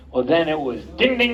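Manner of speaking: ordinary delivery, nothing marked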